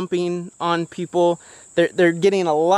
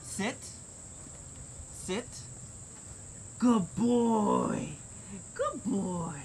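soft dog commands; 3 semitones higher, 13 dB quieter, much slower than usual. Real, not acted. Commanding and praising dog softly.

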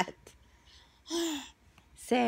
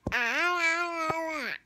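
bright burst of laughter; laughing; 7 semitones higher than usual. Real, not acted. Laughing at toddler.

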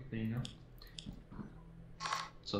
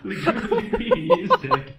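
laughing hard; 12 dB louder, slower than usual. Real, not acted. Reports death while laughing.

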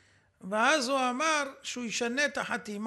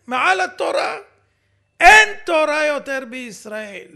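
outraged; 9 dB louder than usual. Real, not acted. Host's outraged commentary.

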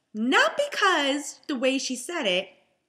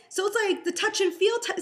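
ordinary talk, nothing marked

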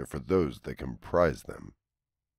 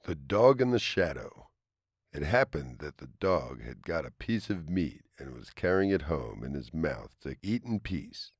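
story narration; 4 dB quieter than usual. A narrator's reading. Reads a fable aloud.

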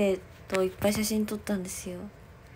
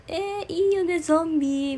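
much higher, gushing "eeh, isn't it great"; gushing agreement; 9 semitones higher, 3 dB louder than usual. Retold or imitated. Gushing to a junior member about loving zombies, high and excited. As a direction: squeal it like a zombie fan finding a fellow fan. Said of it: "みたいな感じで".

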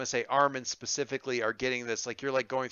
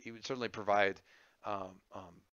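usual speaking voice

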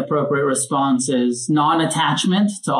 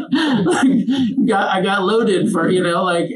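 real laughter breaking into the words, higher pitch; amused, laughing as you confess; 5 semitones higher, slower than usual. Real, not acted. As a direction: talk through your own chuckle. Laughs at own crack-smoking story.

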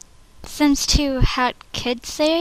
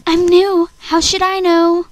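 louder and higher protest; defensive outburst; 5 semitones higher, 4 dB louder than usual. Acted. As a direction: protest your innocence. Audition take, defensive.

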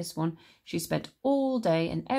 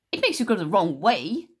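snapped; 7 dB louder than usual. Acted. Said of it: "she snapped".

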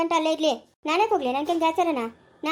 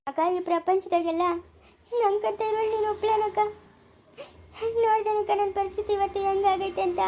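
crying; 4 semitones higher than usual. Acted. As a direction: sob out your misery to your elder sister. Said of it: "ಅಳ್ಬೇಡ, ಸುಮ್ನೆ ಇರು". (ಅಕ್ಕನ ಮುಂದೆ ತನ್ನ ದುಃಖ ಹೇಳುತ್ತಾ ಅಳುವುದು)

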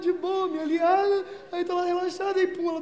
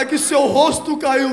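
mock lady telling; 4 semitones higher, 13 dB louder, slower than usual. Woman tells him face fell.

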